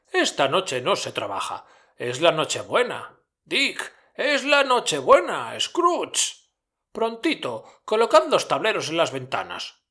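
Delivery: mucho más agudo, festivo; Fezziwig jovial. A narrator's reading. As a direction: anuncia la fiesta con alegría. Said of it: "continuó Fezziwig". (Fezziwig a sus aprendices, agudo y festivo)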